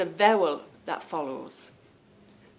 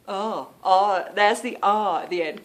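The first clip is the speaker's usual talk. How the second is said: normal talking voice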